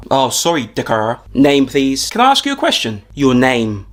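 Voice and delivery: normal talking voice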